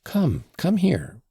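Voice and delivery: commanding call, slowed and firm; imperious cry. Acted. Lise orders Alyosha over, imperious cry. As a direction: summon him like a little queen. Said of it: "she cried imperiously".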